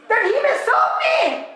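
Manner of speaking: high, ringing preacher's cry; impassioned proclamation. Acted. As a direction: call it out as God's demand. Preacher proclaims God's command.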